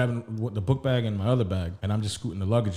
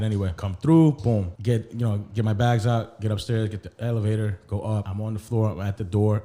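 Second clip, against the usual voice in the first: normal talking voice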